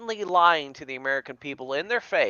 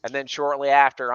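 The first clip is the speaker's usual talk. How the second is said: ordinary talk, nothing marked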